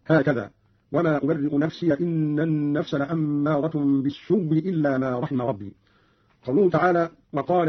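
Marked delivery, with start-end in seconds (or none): 0.9-5.7 slow, "بالسوء" as bis-suwwi; hamza turned to waw. Shown as a demonstration. Teacher showing the second way, "بالسوء" said bis-suwwi. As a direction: merge "بالسوء" into a doubled w before "إلا". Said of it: "إبدالها واوا مكسورة وإدغام التي قبلها فيها".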